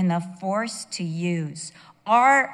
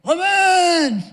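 high, loud drawn-out yell imitating a husband; mimicked husband yelling; 10 semitones higher, 10 dB louder than usual. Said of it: "He's like, "Woman!".

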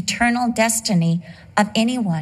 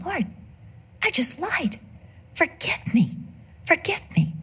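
quiet, higher pleading for forgiveness; a penitent plea, pitched up and softer; pleading prayer; 7 semitones higher, 6 dB quieter than usual. Retold or imitated. Praying in the car after a lie, high and softer. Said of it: "I'm sitting there in the car going".

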